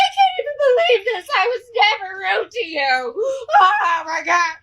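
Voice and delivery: high-pitched, whiny fake crying; mock wailing. Nurse getting up after the beating, mock wailing. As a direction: wail like a wronged victim, hammed-up. Beaten nurse whining, acted.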